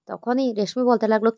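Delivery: ordinary delivery, nothing marked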